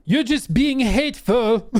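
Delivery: wounded French chef, high and slow; hammed-up Pink Panther French accent; bad French accent. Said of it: "my French accent always turns into Steve Martin".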